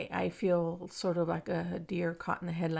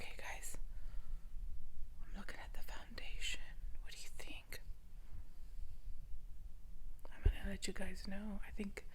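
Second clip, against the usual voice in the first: whispering. Real, not acted. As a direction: hush it, barely voiced. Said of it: "I have to whisper 'cause my grandbaby".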